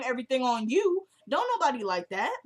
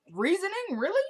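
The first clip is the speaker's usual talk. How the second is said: scoffing, higher echo of her word; incredulous; 7 semitones higher, faster than usual. Retold or imitated. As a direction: pitch jumps up on Reasoning, mocking disbelief. Husband mocks her word, incredulous.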